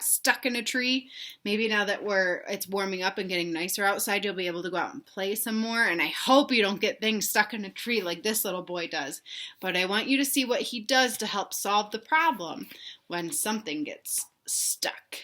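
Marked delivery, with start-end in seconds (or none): none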